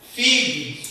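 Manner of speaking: put-on measured call to children across a distance. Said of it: "Ele gritou". (chamando os filhos)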